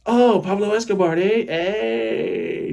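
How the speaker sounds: tactless, over-bright heckle; blurted teasing cheer. Retold or imitated. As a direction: blurt it out high, trailing into a long "hey". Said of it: "my filterless ass goes".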